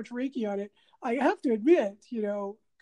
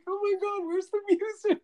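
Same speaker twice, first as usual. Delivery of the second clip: laughing; 9 semitones higher than usual. Retold or imitated. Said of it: "And I'm like".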